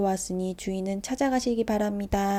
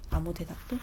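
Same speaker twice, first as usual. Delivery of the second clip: plain reading voice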